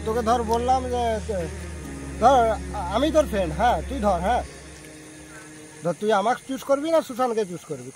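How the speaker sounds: usual speaking voice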